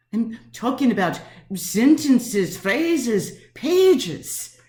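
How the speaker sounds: slow list climbing to a high PAGES; building emphasis. Acted. Lonely woman insisting she talks to herself, emphatic and building up to PAGES. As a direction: punch SENTENCES, PHRASES, then PAGES highest.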